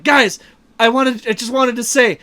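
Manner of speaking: acted-out gushy confession; gushing declaration. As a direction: blurt it out like a heartfelt movie hero. Retold emotional outburst to the group.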